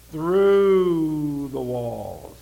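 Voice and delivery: THROUGH THE WALLS stretched very slowly, pitch sinking; drawn-out wonder. Real, not acted. Preacher tells of risen Jesus, long drawn-out emphasis. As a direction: draw the words right out, low. Savouring the miracle for effect.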